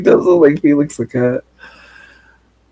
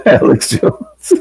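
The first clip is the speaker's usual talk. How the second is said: laughing; slower than usual. Real, not acted. Laughing at the clip.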